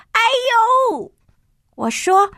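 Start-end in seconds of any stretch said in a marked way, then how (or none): 0.1-1.1 mild, drawn-out yelp; crying out. A narrator's reading. Boy reacts to the howl, mildly crying out. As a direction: yelp it without much force. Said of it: "我大叫".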